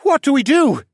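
very high, loud question; urgent shouted question. A narrator's reading. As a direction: ask it desperately over the fighting. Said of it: "the Falcon Man asked".

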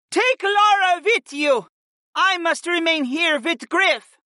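high, slow, emphatic shout; defiant shout back. A narrator's reading. Warrior to her friend, shouting back. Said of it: "the blonde warrior shouted back at her friend".

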